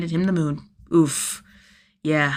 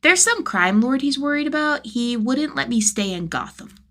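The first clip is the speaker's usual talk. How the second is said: a hint of a young boy, higher, faltering; lightly voiced kid; 7 semitones higher than usual. A narrator's reading. Tim explaining the wizard to Jason, lightly kid-voiced.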